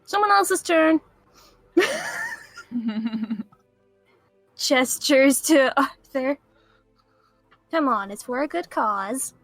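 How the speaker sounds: usual speaking voice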